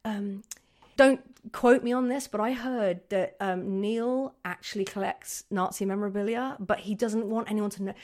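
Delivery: hushed, sly gossip; gossip whisper. Acted. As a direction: half-whisper a juicy rumour. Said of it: "so it'd be like".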